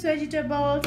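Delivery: normal talking voice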